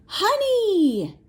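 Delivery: thrilled reveal; excited gasp. Real, not acted. Asking a child what honey bears eat, excited gasp.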